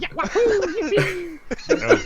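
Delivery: high squeaky wahoo, hammed-up; Mario impression. Teasing a friend about his Italian voice, hammed-up Mario squeak. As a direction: bounce it out like a cartoon plumber. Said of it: "I didn't wanna be Mario".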